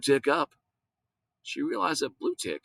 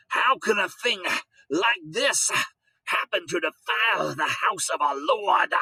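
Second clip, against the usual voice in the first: outraged preacher; 7 semitones higher, 3 dB louder than usual. Acted.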